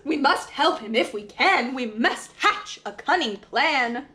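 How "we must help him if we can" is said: ordinary delivery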